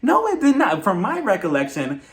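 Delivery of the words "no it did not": higher, louder mock comeback; imitating own reaction to a lie; sassy retort. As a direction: snap it back with attitude.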